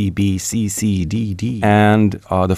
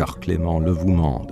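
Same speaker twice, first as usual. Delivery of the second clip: French verse read aloud with care; poem recitation; 3 semitones lower, 4 dB quieter than usual. Acted. Translator quoting the poem's opening line to the host, recited. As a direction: lift the first words, then settle into the line.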